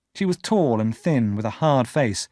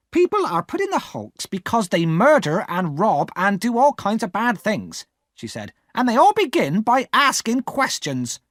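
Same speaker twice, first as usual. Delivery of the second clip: stern scolding; 10 semitones higher than usual. Acted. Sister warning Pip about questions, stern scold. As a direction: warn the boy off, stern.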